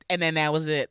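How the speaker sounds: normal talking voice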